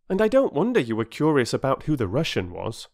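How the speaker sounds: a hint of a schoolboy being reasonable; lightly acted young lad; earnest boy. A narrator's reading. Voices Peter.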